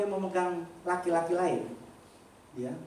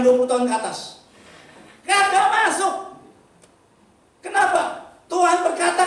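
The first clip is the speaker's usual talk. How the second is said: loud, high preacher's cry to the congregation; fiery preaching; 10 semitones higher, 11 dB louder than usual. Acted. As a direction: thunder it out like a revival preacher. Preaching at full force.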